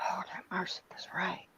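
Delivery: soft, unvoiced whisper. Real, not acted. Agreeing with her friend under her breath, whispered.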